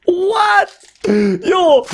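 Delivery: very high cry of shock without much force; strengthless scream. Real, not acted. Card opener out of strength after another hit, spent high shriek. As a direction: squeal it, too spent to scream properly. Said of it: "j'ai même plus de force pour crier comme un fou".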